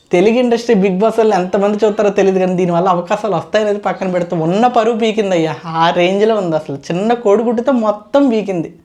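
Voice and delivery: ordinary talk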